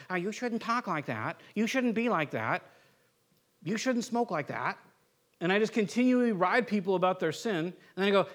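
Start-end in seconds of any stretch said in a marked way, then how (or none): 0.1-4.8 softer, tut-tutting reproach; mock rude scolding. Jerk riding coworkers about their sin, mock scolding. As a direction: nag a coworker in a quiet put-on voice. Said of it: "if I'm rude to everybody at work".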